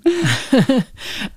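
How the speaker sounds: short high laugh; amused laugh. Real, not acted. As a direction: laugh at the joke. Laughs at "Anytime.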